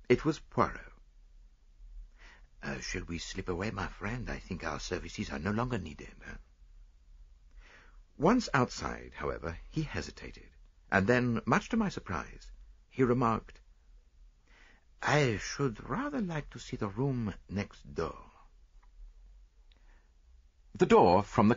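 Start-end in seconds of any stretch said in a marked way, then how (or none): 2.6-6.1 put-on soft, lightly accented detective; discreet Poirot. A narrator's reading. Detective suggests to his friend they slip away, softly discreet. 15.0-18.3 soft, slow, higher detective voice; musing Poirot. A narrator's reading. Detective asking to see the next room, slow and musing. Poirot muses after hesitating.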